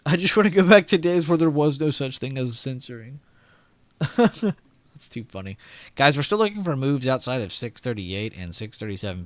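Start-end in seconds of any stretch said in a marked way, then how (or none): 0.1-3.2 louder, higher whining; loud whiny complaint. Shown as a demonstration. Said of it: "See what I mean?". 3.6-5.6 laughing. Real, not acted. Laughing after joke.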